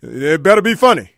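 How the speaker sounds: loud, hard warning from the back; loud menacing demand; loud threat. Retold or imitated. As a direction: bark it like a tough guy. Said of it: "then the dude says".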